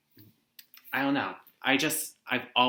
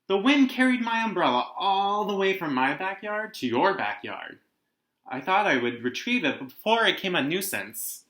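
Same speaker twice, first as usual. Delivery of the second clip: a lightly voiced polite bear, a little higher; slight panda accent; 7 semitones higher, 4 dB louder than usual. Bear Stillwater apologizing to the children, lightly voiced polite panda. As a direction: gently play a courteous panda apologizing. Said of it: "He spoke with a slight panda accent".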